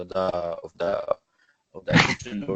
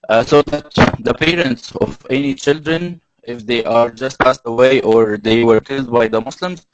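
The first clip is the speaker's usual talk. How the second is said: raised, projected presentation voice; speaking louder; 7 dB louder than usual. Real, not acted. Explaining the cross to an audience, louder. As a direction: speak up for the listeners. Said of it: "I'll try to be louder".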